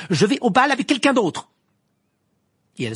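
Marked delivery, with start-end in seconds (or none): 0.1-1.5 very high, very loud outburst; angry shout. A narrator's reading. Hermione announcing she has another date, angry shout. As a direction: shout it in full anger. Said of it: "avec colère".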